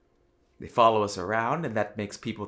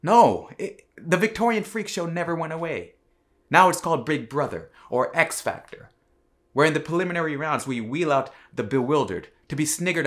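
loud, raised indignant tirade; indignant rant; 4 semitones higher, 6 dB louder than usual. Acted. Condemning the makers of freak-show television, loud and indignant. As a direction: cut in loudly and rail against it.

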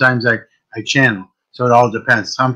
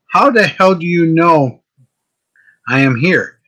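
higher, slower, startled How the hell; astonished question; 5 semitones higher, slower than usual. Retold or imitated. Soul asking the shaman, slow and astonished. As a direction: ask it stunned, drawing it out. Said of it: "I looked at the shaman and go".

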